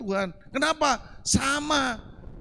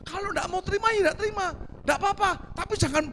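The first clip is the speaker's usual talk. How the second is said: retold angry reply; 10 semitones higher, faster than usual. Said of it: "Orangnya marah".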